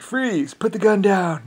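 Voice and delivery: mock police order. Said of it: "they're like".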